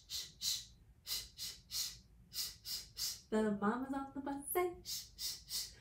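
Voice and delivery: soft breathy shh sounds; hushing shh. Acted. Mama on the bus hushing the babies, soft shh.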